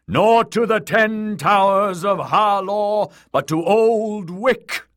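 fervent priest swelling and easing; prophet preaching. Acted. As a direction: rouse the faithful to the old way.

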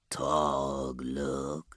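low, drawn-out Rotbringer voice; wary, perhaps worried. A narrator's reading. Rotbringer watching a pained man, warily asking. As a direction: draw the name out low and wary. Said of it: "There was a wariness in the Rotbringer's voice".

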